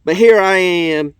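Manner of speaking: 大げさに盛り上げて読む; reading shirt aloud. Shown as a demonstration. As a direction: read it out with big enthusiasm, slowing at the end. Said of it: "It says".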